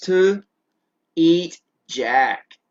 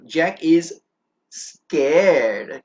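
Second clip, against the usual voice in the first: ordinary talk, nothing marked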